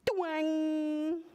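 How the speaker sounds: high, ringing twang; drawn-out storybook twang; twang sound effect. A narrator's reading. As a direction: imitate a plucked web string. Sound word in picture book.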